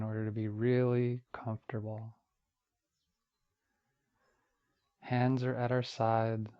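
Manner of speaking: unhurried meditation voice with long pauses between phrases; guided meditation. Acted. Teacher guiding listeners through Yoga Nidra body relaxation, calm meditation voice. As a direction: ease them gently down, one phrase per breath.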